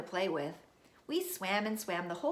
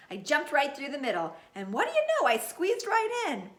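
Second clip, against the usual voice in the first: excited; 7 semitones higher, 7 dB louder than usual. A narrator's reading. Triumphant narration of squeezing in.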